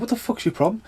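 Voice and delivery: mock confrontation. Man confronting a drunk who throws things, mock challenge. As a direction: square up to him in the retelling. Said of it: "I was like".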